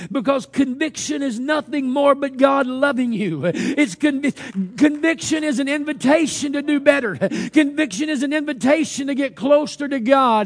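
high, loud, driving Pentecostal preaching; full-force preaching. Real, not acted. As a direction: preach it out loud with urgent, hammering phrases.

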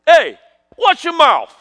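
high, drawn-out New York scolding; hammed-up warning to a cheeky kid; mock scolding elder. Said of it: "I hear 'em say".

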